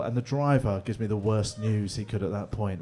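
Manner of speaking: ordinary delivery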